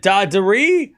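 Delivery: Dadari as DAH-duh-ree, weight on the first syllable; pronunciation option. Shown as a demonstration. Streamer gives chat a pronunciation to vote on, DAH-duh-ree for Dadari. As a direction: offer Dadari with a long open DAH up front. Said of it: "which one".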